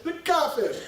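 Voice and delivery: mock Salafi accent, pitched high; emphatic Salafi accent. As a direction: pronounce it with emphatic Salafi relish. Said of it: "my most emphatic Salafi accent".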